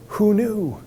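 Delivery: ordinary talk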